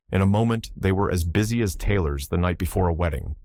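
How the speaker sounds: ordinary delivery, nothing marked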